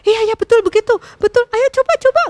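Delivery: high, loud coaxing; eager urging. A narrator's reading. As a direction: egg her on impatiently. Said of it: "tidak sabar".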